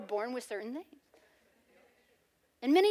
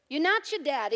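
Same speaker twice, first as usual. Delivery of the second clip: normal talking voice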